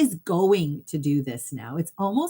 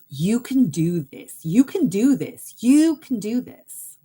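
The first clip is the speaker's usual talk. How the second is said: rising louder with each repeat; building encouragement; 5 semitones higher, 6 dB louder than usual. Retold or imitated. Higher self rallying the client, louder each time. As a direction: push harder every time you say it.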